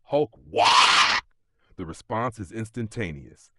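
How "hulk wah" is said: very loud, rising battle roar; the god of the orcs roaring to his people; huge roar. A narrator's reading. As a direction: throw the war cry out to the whole world. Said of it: "and he roars".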